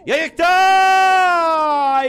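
long drawn-out shout; excited shout. Real, not acted. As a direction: stretch it out, shouting. Goal chance commentary shout.

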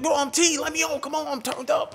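high, hammed-up hype-man voice; mock the DJ begging to stay on; hyped-up DJ. Mocking hyped DJ on stage.

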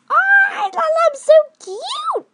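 high, loud cooing squeal; adoring squeal. Real, not acted. Showing viewers the lamb, a high adoring squeal.

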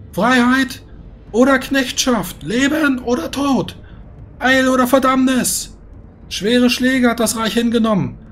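booming voice over the battlefield; magically amplified voice. Acted. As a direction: proclaim it across the whole army. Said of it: "eine magisch verstärkte Stimme klingt".

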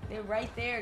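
high, loud panicked yelling; panicking gamer. Real, not acted. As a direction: yell that they're right there. Killers close, panicking.

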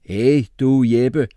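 loud, high, firm interjection; interrupting. A narrator's reading. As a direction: cut in on the argument. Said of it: "afbrød Singh".

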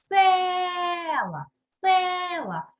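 села with a long, loud, stretched «е»; stressed first syllable. Shown as a demonstration. Teacher shows pupils the check word, «е» in села drawn out. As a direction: hold the first vowel of села long and high. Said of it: "щоб склад став наголошений".

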